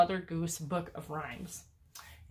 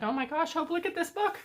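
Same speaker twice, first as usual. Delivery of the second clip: excited; 11 semitones higher than usual. Retold or imitated. Excited self quote to daughter.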